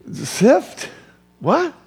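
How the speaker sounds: high incredulous questions; preacher acting out a listener's shock; mock disbelief. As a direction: act baffled at the news.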